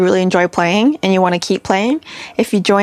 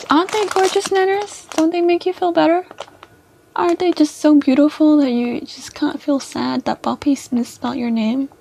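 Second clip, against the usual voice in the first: higher, sweet coo to a pet; 5 semitones higher, 3 dB quieter than usual. Acted. Streamer cooing to Nanners over the flowers. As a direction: coo like to a baby.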